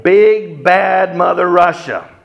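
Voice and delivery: slow dramatic emphasis; big bad boom. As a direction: puff it up like a villain's title. Dramatic naming of Russia.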